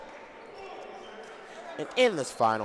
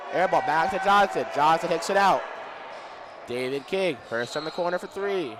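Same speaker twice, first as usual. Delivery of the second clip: play-by-play; 3 semitones higher than usual. Acted. Live game commentary.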